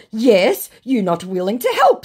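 loud, high put-on voice; mock indignant accusation; accusing scammer. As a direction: blame them as the scammer. Voicing the scammer's blame.

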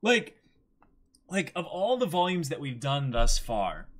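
high, loud gushing; excited. Real, not acted. Telling co-host about the best volume, excited gushing. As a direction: gush about it.

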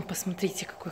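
whisper. Real, not acted. Showing the bouquet.

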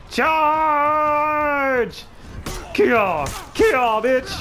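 long high "charge", then loud attack yells; war cry. Pretend. Gamer leading soldiers into battle, war cries. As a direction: bellow the charge high and drawn out.

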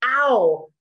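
a pained ow; 1 demo ow. Shown as a demonstration. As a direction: say it like a little hurt. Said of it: "These are things like".

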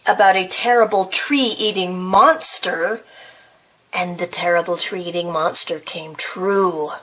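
plain reading voice

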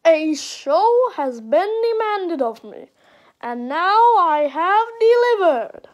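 slow, loud, jerky animatronic voice; Funtime Foxy. Acted. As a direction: boom it out slowly like a theatrical robot host. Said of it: "I'll try my best impression of Funtime Foxy".

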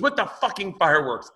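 high accusing woman's voice; accusing neighbor. Retold or imitated. Neighbor blaming him for fireworks, high mimicry. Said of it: "She goes".

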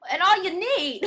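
loud, high, hammed-up impression; celebrity impression. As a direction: belt it out as a comic impersonation. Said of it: "I can do".

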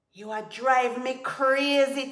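high, loud, maddened cry; exasperated roar. A narrator's reading. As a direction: roar in utter frustration. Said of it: "Tiger roared".

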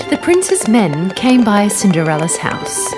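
usual speaking voice

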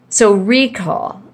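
ordinary talk

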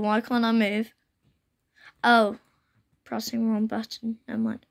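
ordinary delivery

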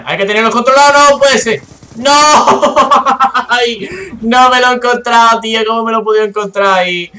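sudden high, loud outburst of game rage; yelling in real annoyance at dying; real frustration. Real, not acted.

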